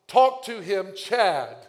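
loud, high mock proclamation; mock divine banner. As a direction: announce it like a flashing neon sign. Imagined neon banner message.

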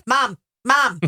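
put-on loud kid yelling for mom; mock mom-calling. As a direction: pester Mom with loud, repeated calls. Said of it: "So the mom calling is".

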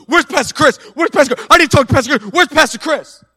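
high, loud frantic demand; imitating a woman storming the pastor's office. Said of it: "storms the office and goes".

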